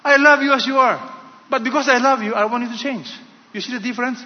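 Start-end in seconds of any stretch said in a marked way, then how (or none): 0.0-3.1 lightly voiced God, higher and louder, earnest declaration; God speaking. God telling people he loves them, emphatic declaration. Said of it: "God is saying".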